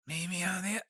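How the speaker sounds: mock gravelly crooning. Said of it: "doing an impression of how he sings".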